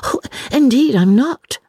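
put-on high, eager girlish denial; exclaimed protest. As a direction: protest quickly that you are not shocked. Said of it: "exclaimed Lucy".

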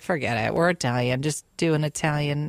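low, soft, brushing it off; mock dismissive. Telling mom to drop the Irish menu, low soft dismissive. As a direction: wave the idea away in a low hush. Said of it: "I was like".

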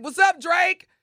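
excited greeting. Pretend. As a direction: call out to him like a superfan. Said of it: "Be like".

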